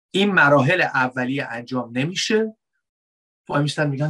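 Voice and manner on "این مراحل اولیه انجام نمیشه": normal talking voice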